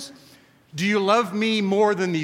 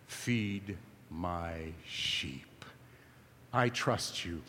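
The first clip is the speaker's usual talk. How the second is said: slow intimate whisper, much lower and softer; 11 semitones lower, 11 dB quieter, much slower than usual. Acted. Jesus leaning in close to Peter, whispering slowly. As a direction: lean in and breathe it. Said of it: "Jesus leans in and says".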